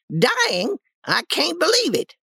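very high-pitched disbelieving aunt; shocked. Acted. Aunt hearing Tom is dying, high shocked voice.

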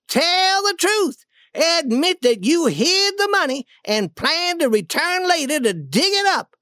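high-pitched, insistent accusation; acted anger, words driven home; furious demand. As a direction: force a confession out of him. Duke demands confession while choking king.

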